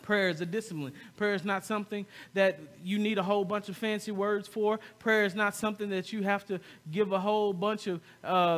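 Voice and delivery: ordinary talk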